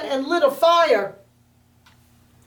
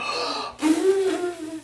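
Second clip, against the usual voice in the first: big gasping inhale; wolf filling his lungs; deep breath in; 7 semitones higher, 3 dB quieter than usual. Acted. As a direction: suck in a huge breath before blowing. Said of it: "took a deep breath and he went".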